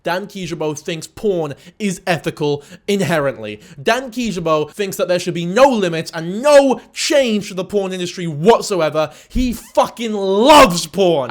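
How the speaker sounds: slow gasp building to a loud mock outrage; imitating outraged commenters, slow then loud. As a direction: ham up the pearl-clutching commenter. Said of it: "comments of people going".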